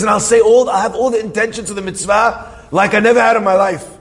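high, insistent promise in a retelling; pleading. Retold or imitated. Friend promises full intention for the mitzvah, pleading. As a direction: act out an earnest plea. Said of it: "He's begging, pleading".

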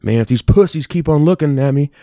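put-on quoting the drunk guy. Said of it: "was like".

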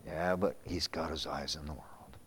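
low, hushed, sober answer; subdued reply. Acted. Preacher answering an imagined objector, hushed. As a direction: drop low and soft to counter it.

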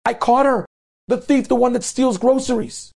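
high-pitched public denunciation; acted shrieky accusing call; calling out publicly. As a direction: announce the thief to the whole store. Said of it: "she starts calling out in front of".

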